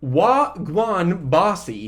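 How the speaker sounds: put-on slow, stilted go at a Jamaican accent; mock outsider's Jamaican patois; bad Jamaican accent. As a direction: Jamaican greeting without the accent, spelled out slowly. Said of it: "you don't go".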